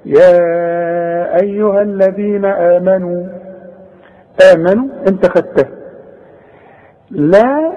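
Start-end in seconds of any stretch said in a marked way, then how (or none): 0.1-3.3 يا أيها with the ا of يا held for four counts; four-count madd. Shown as a demonstration. Teacher demonstrates the four-count madd on يا أيها. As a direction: stretch the يا in يا أيها long and slow. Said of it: "بنمده أربع حركات".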